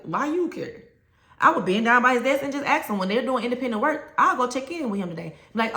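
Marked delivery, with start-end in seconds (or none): 0.0-0.8 put-on higher, dismissive kid voice; mimicking a sullen student. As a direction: toss it back like a bored boy. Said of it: "he would look at me like".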